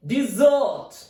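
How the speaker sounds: "Desert" as di-ZERT, long second syllable; stress on second syllable. Shown as a demonstration. Teacher repeating the verb "Desert", stressed di-ZERT. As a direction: hit ZERT hard in "Desert". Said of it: "The stress is on second syllable".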